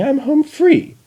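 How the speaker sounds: high, gleeful triumph; triumphant. Acted. Chrysalis gloating to herself, high and triumphant. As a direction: crow it like you've won. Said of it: "she said triumphantly".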